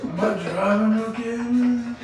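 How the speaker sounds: lightly voiced dazed stoner; stoner impression. Stoner driver asking his buddy, dazed voice. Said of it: "[name] and [name] Up in Smoke".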